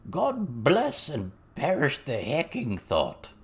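slow, high, affronted mock aristocrat; aristocratic goblin. A narrator's reading. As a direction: draw it out like a scandalised goblin lord. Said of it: "The aristocratic act had slipped a bit".

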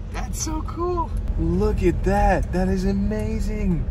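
high, slow and marveling; drawn out in wonder; excited awe. Real, not acted. Marveling at the mosque.

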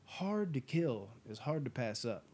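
ordinary talk, nothing marked

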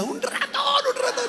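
very high, soft, laughing cry of "Un ratón"; squeaky mock panic. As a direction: squeal it like a frightened churchgoer. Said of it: "decía".

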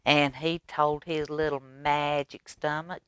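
ordinary delivery, nothing marked

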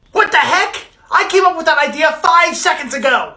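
high-pitched indignant complaint; outraged protest. Acted. Son whose idea was credited to his brother, indignant.

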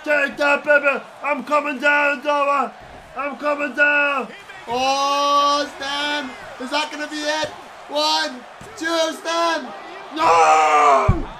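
high, shouted play-by-play; excited match commentary. Pretend. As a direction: call the action at a high-pitched, drawn-out yell. Excited shouting at the game action.

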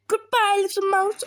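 high, loud frightened goodbye; panicked farewell. Acted. Snake flees mouse and Gruffalo, panicked.

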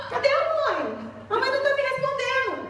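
high, laughing ask; daughter asking. Retold or imitated. Daughter asking dad where mom is, high and laughing. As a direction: laugh through the daughter's complaint.

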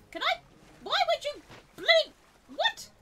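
high, loud bursts of disbelief; exasperated yelling. Real, not acted. Gamer whose catch keeps blinking out, yelling in exasperation. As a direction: yell each bit as it slips away.

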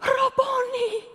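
high, drawn-out cry of recognition; overcome recognition. Acted. Mary suddenly recognizing her teacher, overcome high cry. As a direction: let it soar high and slow, overwhelmed.

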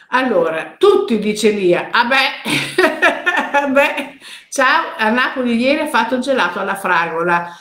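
ordinary talk, nothing marked

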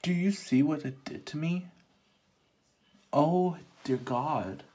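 exasperated despair at the mirror; dismayed outcry. Real, not acted. Dismayed at her own face.

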